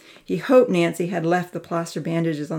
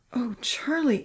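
soft, high-pitched mild wail; restrained wail of distress for a friend; 6 semitones higher, 5 dB quieter, slower than usual. A narrator's reading. Amy sees Charlie's injured arm, softly wailing. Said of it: "Amy wailed".

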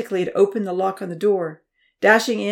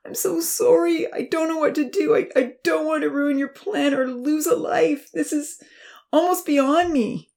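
sobbing; 9 semitones higher, 4 dB louder than usual. A narrator's reading. Uncle apologising to the professor, sobbing. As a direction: sob through the words. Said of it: "Louis began to sob".

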